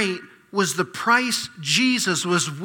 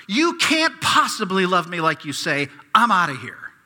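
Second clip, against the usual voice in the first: louder, higher, pleading then accusing God; anguished complaint; 3 semitones higher, 6 dB louder than usual. Pretend. Sufferer demanding answers from silent God, anguished outcry. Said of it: "Put yourself here".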